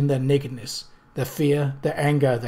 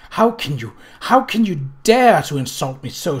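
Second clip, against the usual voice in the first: crying out in rage; 2 semitones higher, 6 dB louder than usual. A narrator's reading. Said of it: "she cried".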